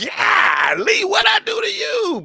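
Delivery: very high, loud mock-wounded squeal; exaggerated protest. Host acting out his reaction to a viewer's emoji, squealed protest. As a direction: shout it way up high, hammed-up. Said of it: "I'm like".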